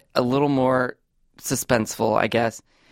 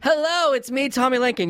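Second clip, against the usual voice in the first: excited greeting; 13 semitones higher than usual. Acted. Hammed-up host greeting.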